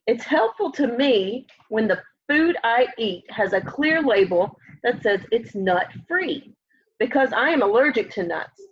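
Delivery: ordinary delivery, nothing marked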